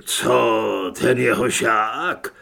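loud, low, slow disbelief; astonished crook. A narrator's reading. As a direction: ask it low and loud, amazed. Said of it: "oči se mu údivem přestaly protáčet". (hlasitý hluboký údiv)

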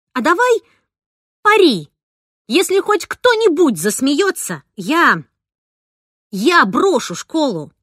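put-on girl challenging her friend to a bet; heated challenge. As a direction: dare him hotly.

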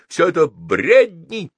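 high, loud, drawn-out dismissal; emphatic cry of rejection; shout. A narrator's reading. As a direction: shout it down slowly and defiantly. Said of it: "крикнул Геннадий".

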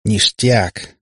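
ordinary delivery

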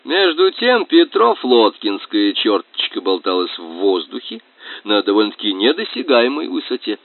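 usual speaking voice

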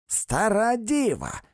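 high, slow shouted repeat; repeated insult. A narrator's reading. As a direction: hammer the insult home.

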